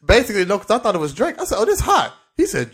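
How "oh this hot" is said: excited. Retold or imitated. Excited about the song.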